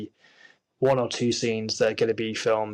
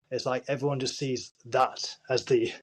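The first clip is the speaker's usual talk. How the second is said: ordinary talk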